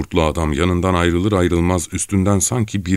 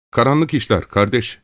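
timid, higher-pitched reply; trembling voice; 7 semitones higher than usual. Acted. Gavrila answering his captor's question, trembling voice. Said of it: "titrek bir sesle".

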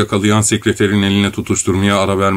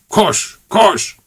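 urgent; 10 semitones higher than usual. A narrator's reading. Urging to run.

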